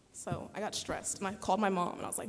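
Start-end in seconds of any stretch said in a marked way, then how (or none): none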